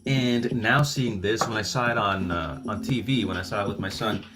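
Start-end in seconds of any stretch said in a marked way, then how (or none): none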